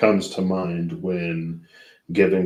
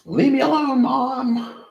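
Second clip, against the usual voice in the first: put-on high, pouty child protesting; whiny kid voice; 4 dB louder than usual. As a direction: whine it like a sulky teenager. Whiny kid talking back to mom.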